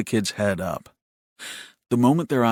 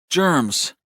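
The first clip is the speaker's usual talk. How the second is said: higher, falling one-word answer; a fixed, repeated reply in a higher voice; insistent little brother; 6 semitones higher than usual. A narrator's reading. Frowning brother at the towel machine, insistent.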